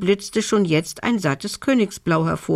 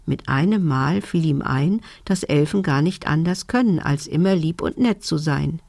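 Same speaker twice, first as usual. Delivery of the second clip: usual speaking voice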